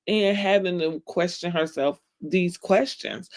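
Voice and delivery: ordinary talk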